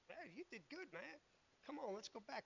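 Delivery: soft, hushed, kindly praise; gentle encouragement. Retold or imitated. Jesus encouraging Peter on the water, soft and kind. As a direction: speak it softly like a proud friend.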